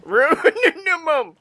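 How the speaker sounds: high, slow, hammed-up grumble; a silly, high, stretched-out echo of a scold; mock old grouch. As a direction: drag it out like a cranky old-timer. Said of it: "echoes and mocks it".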